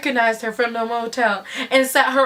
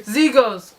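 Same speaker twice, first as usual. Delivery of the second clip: ordinary delivery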